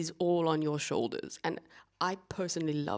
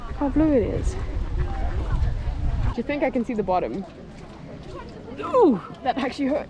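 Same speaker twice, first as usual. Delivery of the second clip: excited; 7 semitones higher, 7 dB louder than usual. Real, not acted. Wading into the cold lake.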